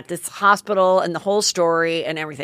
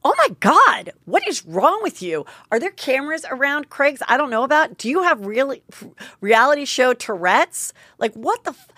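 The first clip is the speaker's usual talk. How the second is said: higher-pitched indignant rant, punchy opening; retold snapping outburst; 6 semitones higher than usual. Hurt mother snaps at Brandy across the table, retold outburst. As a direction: re-enact losing it at someone, incredulous and high. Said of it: "I did the exact same thing".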